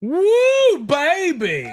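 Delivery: high-pitched celebratory holler; excited whoop. Real, not acted. Host celebrates the contestant's answer, whooping. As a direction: whoop it out loud and high, then ease off.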